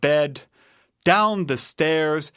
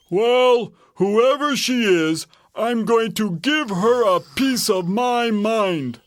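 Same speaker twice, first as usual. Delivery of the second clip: raised, blustering bear voice; indignant Papa Bear; 8 semitones higher, 3 dB louder than usual. A narrator's reading. Papa Bear vows to scold her.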